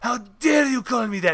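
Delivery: imitated indignant Spaniard's accent; [name]. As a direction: take offence in a put-on Spanish accent. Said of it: "he just goes and he goes".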